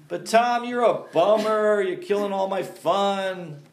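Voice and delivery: high, drawn-out whiny complaint; mock whining. Churchgoer moaning to the preacher about spoiled fun, mock whine. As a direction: whine it slowly like a spoilsport's victim. Voicing congregants' objections.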